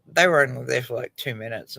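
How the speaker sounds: ordinary talk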